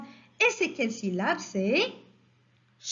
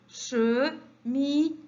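"Cheminée" slow, in three separate beats; syllable by syllable; slower than usual. Shown as a demonstration. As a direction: separate che, mi, née in "Cheminée". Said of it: "la deuxième ou bien la dernière". (« Cheminée » lentement, syllabe par syllabe)

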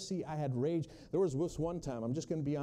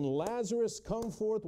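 raised, ringing call to the dead; commanding call; 6 semitones higher, slower than usual. Acted. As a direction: call him out of the grave. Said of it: "of Jesus' voice saying".

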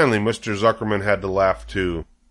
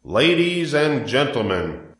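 lightly voiced public-address announcer; fair loudspeaker announcer; 4 semitones higher, slower than usual. A narrator's reading. Loudspeaker addressing the fair crowd, mild announcer voice. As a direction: proclaim it to the fairground crowd.

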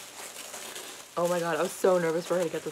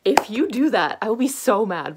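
mock warning; 3 semitones higher, 9 dB louder than usual. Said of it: "And I'm like".

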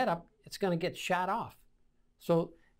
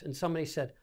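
ordinary talk

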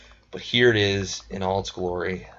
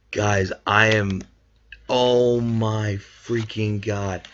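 stunned; 3 dB louder, much slower than usual. Real, not acted. Stunned after shiny.

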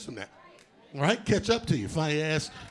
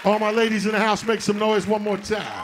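loud call to the crowd; rally the audience like an MC; hype host shout; 4 semitones higher, 3 dB louder than usual. Real, not acted.

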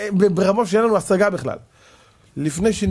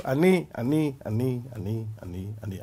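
ani ani sinking lower and softer to near nothing; fading ani ani; 5 semitones lower, 6 dB quieter than usual. Shown as a demonstration. Preacher mocking the mourner's self-pity, fading ani ani. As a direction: let each אני fade away, dropping lower and quieter.

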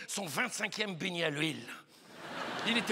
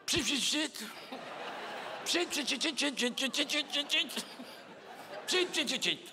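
rapid hissed spray bursts; perfume spray noises; 3 semitones higher than usual. Retold or imitated. Comedian mimes dousing themselves in perfume, imitated spraying. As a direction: imitate a perfume atomiser, squirt after squirt.

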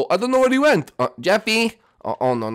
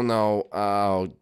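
ordinary talk

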